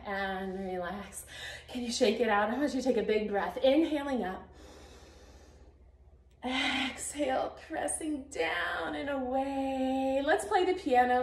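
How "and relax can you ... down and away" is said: soothing stretch-class voice; calm cooldown cues. Acted. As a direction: settle them into the breath.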